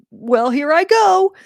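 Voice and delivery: a rueful here-we-go shrug in the voice; self-aware quip. Shown as a demonstration. Father of the bride laughing at his own tears, wry. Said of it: "self-aware and self-conscious of".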